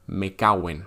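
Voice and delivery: "me caguen" said as one word, mecaguen; run together as one word. Shown as a demonstration. Teacher showing learners a run-together "me caguen". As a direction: blend "me caguen" into a single word. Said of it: "como si fuera una única palabra".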